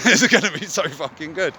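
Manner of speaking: said through a high laugh; gleeful laugh while speaking. Real, not acted. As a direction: grin and laugh the words out. Laughs while anticipating the drink.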